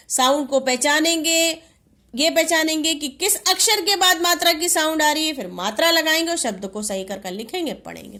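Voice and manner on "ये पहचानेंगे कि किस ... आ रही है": normal talking voice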